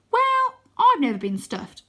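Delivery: put-on high, slow boy voice; Wyn's voice. Wyn answering about being stuffed, high slow voice. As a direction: pitch it up and drawl it out.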